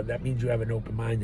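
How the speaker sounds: ordinary talk, nothing marked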